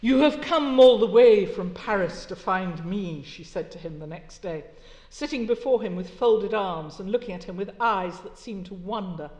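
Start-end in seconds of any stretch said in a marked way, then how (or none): 0.0-3.2 read aloud with force. Acted. Lecturer reads Laure's line aloud.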